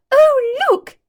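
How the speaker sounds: high, loud delighted cry; children spotting a new toy; excited children. A narrator's reading. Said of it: "they cried".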